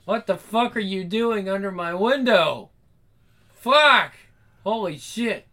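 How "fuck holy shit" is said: sudden high loud curse; startled cry. Acted. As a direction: blurt it out in shock. Caught by the dad.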